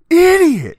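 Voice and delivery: sudden high, loud exasperated yell. Retold or imitated. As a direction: blurt it out right before the punch. Gon's friend fed up, punches him.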